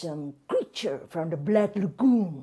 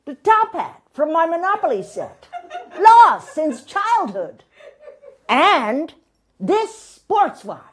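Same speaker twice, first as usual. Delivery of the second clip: triumphant reveal; 11 semitones higher, 9 dB louder than usual. Acted. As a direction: unveil each find like a treasure. Guest triumphantly lists his finds.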